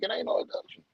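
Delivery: soft, breathy mock cop; bored cop, mocked quietly; dismissive police voice. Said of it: "The police like".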